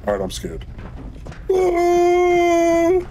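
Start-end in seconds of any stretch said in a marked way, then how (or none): 1.5-3.0 loud high shriek of fright; terrified scream. Real, not acted. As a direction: scream in terror.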